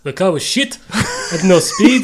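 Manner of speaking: blunt put-on Niki, dragging out the last words; Niki Lauda voice. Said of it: "he'll sit there and be like".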